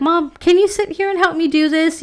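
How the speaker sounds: imitating young son. Five-year-old son asking mom for help, imitated in a high child voice. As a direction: lightly mimic a five-year-old's pleading request. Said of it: "he came and he's like".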